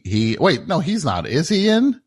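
ordinary talk, nothing marked

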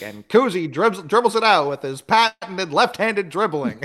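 lightly imitated old-timey announcer; a hint of a vintage play-by-play voice; old radio announcer. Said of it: "they throw it back to the old-timey announcer".

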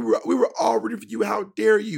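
high, rapid-fire mock tirade; acted TV-host meltdown; Tyra yelling. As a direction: rant it fast and high, outraged. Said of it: "right when she yells".

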